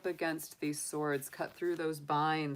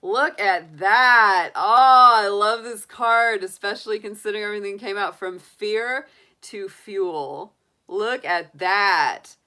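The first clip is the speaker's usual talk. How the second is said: excited; 4 semitones higher, 14 dB louder than usual. Real, not acted. Excited about drawn oracle card.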